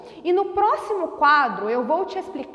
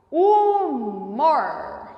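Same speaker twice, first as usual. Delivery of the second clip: slow motion. Shown as a demonstration. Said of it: "Então vamos pronunciar".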